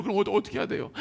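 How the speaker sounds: usual speaking voice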